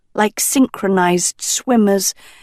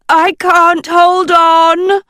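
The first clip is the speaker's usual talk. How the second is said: high, loud and slow desperate howl; 9 semitones higher, 5 dB louder, slower than usual. A narrator's reading. A girl dangling above snakes, slow desperate howl. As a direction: cry out as your grip slips. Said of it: "Dot howled".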